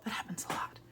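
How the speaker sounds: whispered aside; a confiding whisper. Real, not acted. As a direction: drop to a breathy whisper.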